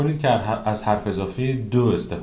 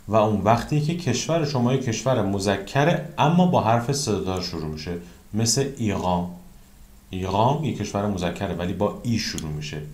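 ordinary delivery

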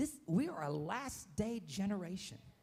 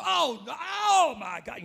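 higher, louder, drawn-out slow wail of Oh no; dismayed movie gasp; 11 semitones higher, 13 dB louder, slower than usual. Retold or imitated. Pastor imitating himself at a bad movie scene, loud slow horrified wail. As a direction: moan in horror at the bad part. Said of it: "I go".

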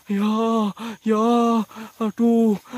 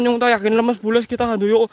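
usual speaking voice

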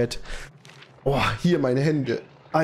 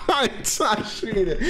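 laughing; 6 semitones higher, much slower than usual. Real, not acted. Laughing right after donation.

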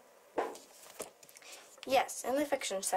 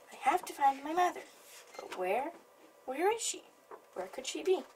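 higher, anxious little bird asking where mother is; a worried baby bird, pitched up; 7 semitones higher than usual. Acted.

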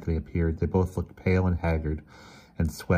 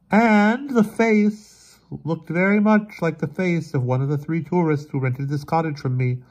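high, strained voice starting very slowly; 11 semitones higher, 5 dB louder than usual. A narrator's reading. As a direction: force out the dreadful revelation, high and halting. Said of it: "in a strained voice".

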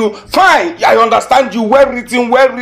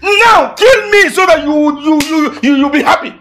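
frantic shouted dare; furious shout; 6 semitones higher than usual. Acted. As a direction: scream it at her, daring her to kill him. Boyfriend screams in full quarrel.

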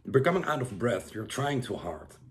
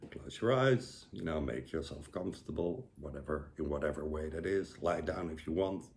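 soft, quiet guiding voice; calm breathing-coach instructions; guided breathing; 6 semitones lower, 5 dB quieter than usual. Acted. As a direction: ease them into the exercise gently. Leads breathing exercise.

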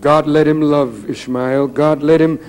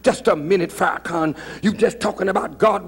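ordinary talk, nothing marked

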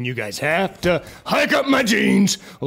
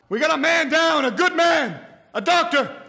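alarmed shout; 10 semitones higher, faster than usual. Acted.

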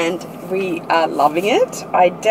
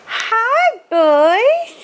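very high, squealed hello; excited greeting; much slower than usual. Real, not acted. Mum coming home to her boys, excited high squeal. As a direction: greet little ones in a high sing-out.

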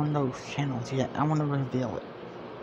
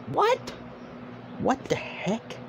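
shocked what; 9 semitones higher, 4 dB quieter, slower than usual. Real, not acted. Reading a baffling comment, shocked outburst. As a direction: voice jumps much higher in disbelief.

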